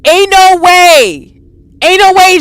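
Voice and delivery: high, loud, very slow "Ain't no way"; slow panicked disbelief. Real, not acted. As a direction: drag it out in dread. Scared player again.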